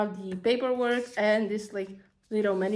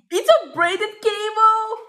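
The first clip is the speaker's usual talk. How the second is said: much higher, louder, drawn out slowly; thrilled discovery of a braided cable; delighted surprise; 10 semitones higher, 6 dB louder, slower than usual. Real, not acted. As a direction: gasp it out in disbelief.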